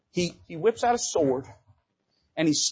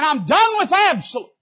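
voiced convert's vow; 10 semitones higher, 7 dB louder than usual. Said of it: "They're saying".

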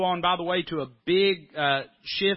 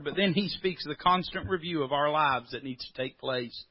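ordinary talk

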